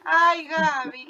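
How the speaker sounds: high, drawn-out real surprise; delighted amazement. Real, not acted. Listener moved by Gaby's memory, amazed.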